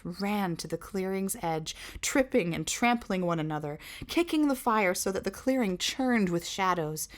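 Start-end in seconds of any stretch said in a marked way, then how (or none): none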